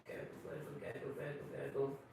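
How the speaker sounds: Santa's belly gurgling, low; low gurgle. Acted. Imitating tummy noise.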